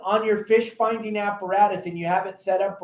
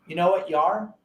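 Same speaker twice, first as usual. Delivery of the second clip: ordinary talk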